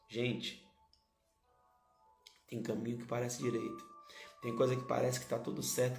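ordinary delivery

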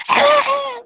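high, loud wordless wail; imitated woman's scream. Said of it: "was yelling at me like this".

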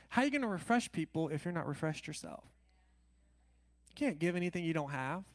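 ordinary talk, nothing marked

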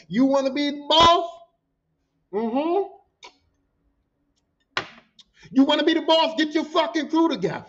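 high, forceful dressing-down; imitated angry boss. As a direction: lay into a new manager, mimicking the big boss. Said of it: "That's how he said".

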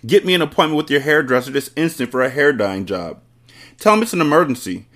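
shouting. A narrator's reading. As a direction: order it as the father springing into action. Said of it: "the father shouted".

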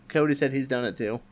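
ordinary talk

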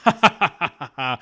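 gloating villain chuckle; evil laugh. Acted. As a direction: cackle like a smug villain. Villain gloating over trapped prey.

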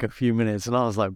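ordinary delivery

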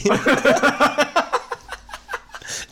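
high giggling cackle; laughing. Real, not acted. Reacting to his own joke.

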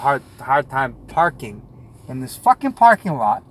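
usual speaking voice

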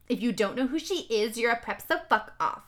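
ordinary delivery

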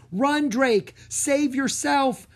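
loud, high, desperate cry; urgent shout. A narrator's reading. Breen urging Drake to run, loud urgent shout. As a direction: scream at him to flee. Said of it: "Breen shouted".

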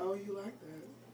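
slow, whispery attempt at sexy. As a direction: purr it slowly as a playful sexy line. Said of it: "No, say it like sexy".

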